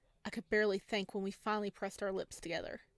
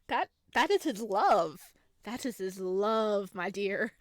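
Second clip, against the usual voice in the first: coy loud character voice; 8 dB louder than usual. Acted. Voicing character explaining coyly.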